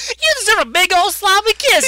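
high-pitched mock Southern belle. Quoting Talia's kiss offer to the hero, hammed-up Southern belle. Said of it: "like, a Southern belle".

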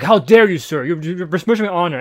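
hammed-up outrage over wounded honor; mock-offended political grandstanding; mock indignation. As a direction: imitate an affronted politician, loud at the start. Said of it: "everyone does this in politics".

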